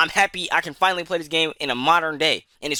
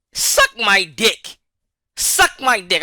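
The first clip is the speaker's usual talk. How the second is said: louder, higher, the first one slow and dragged; angry retort; 5 semitones higher, 6 dB louder, much slower than usual. Real, not acted. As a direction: spit it out at the developer. Ranting at the developer.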